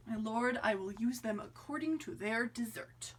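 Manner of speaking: whispered, polite reply. Acted. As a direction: answer under your breath. Courtier answers softly.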